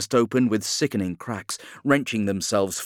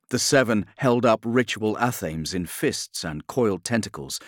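usual speaking voice

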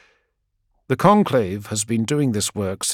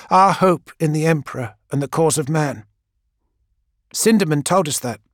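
put-on higher young voice declaring her belief; devout young woman; 4 semitones higher than usual. Gang girl telling Keeler she is their hope, devout. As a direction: recite it like a creed.

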